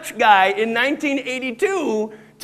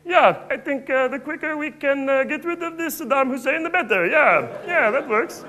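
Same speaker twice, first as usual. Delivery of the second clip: higher Kermit-like Dutch PM voice; imitating Balkenende; 5 semitones higher than usual. Comic mimics the Dutch prime minister, higher and Kermit-like. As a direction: do the prime minister as a chirpy frog. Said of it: "Jan Peter Balkenende is saying".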